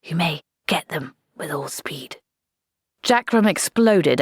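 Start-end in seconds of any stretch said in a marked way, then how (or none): 0.0-2.2 softer, hushed go-ahead; officer quietly releasing the charge. Acted. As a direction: keep it down but give the order. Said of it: "whispered Blouse".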